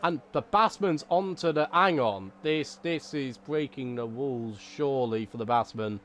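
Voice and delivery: low, dampened-down race commentary; deliberately subdued low monotone. Shown as a demonstration. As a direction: keep the enthusiasm out, low and monotone. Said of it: "talk in a very monotone voice".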